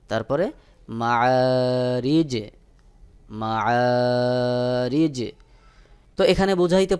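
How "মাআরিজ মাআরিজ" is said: মাআরিজ recited very slowly, the final জ stopped with a light bounce; Quranic recitation demo. Shown as a demonstration. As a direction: chant মাআরিজ slowly, echoing the sakin জ. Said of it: "সহি উচ্চারণ হবে". (মাআরিজ ধীরে তিলাওয়াতের সুরে, শেষে কলকলা)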